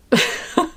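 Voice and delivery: high burst of giggles; helpless short laugh. Real, not acted. Streamer getting delirious, helpless laughing. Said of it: "don't know why I'm laughing so much".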